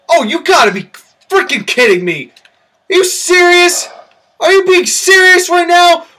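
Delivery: can't believe what you see; disbelief. Real, not acted. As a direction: commentator shocked by the attack. Disbelief at ringside attack.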